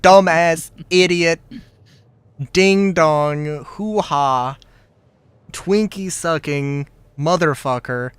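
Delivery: loud comic insult string, fading down; mock angry rant. Streamer voicing a rude client, hammed-up angry insults. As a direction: hurl silly insults, cooling off. Acting out an abusive mercenary client.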